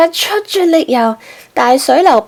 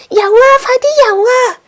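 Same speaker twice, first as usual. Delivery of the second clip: high, loud urgent yell; shouting; 13 semitones higher, 4 dB louder than usual. A narrator's reading. Rossi urging Gasper to swim, shouting. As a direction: shout at him to swim fast. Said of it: "樂斯就大嗌".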